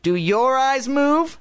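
high, slow squeaky echo; drawn-out high ventriloquist's voice; Slappy's dummy voice. A narrator's reading. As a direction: parrot the question in a slow puppet squeak. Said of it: "Slappy asked Ben".

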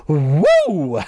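loud blown-out "woo"; relieved whoop. Acted. Sister freed from the hot mask, relieved whoop.

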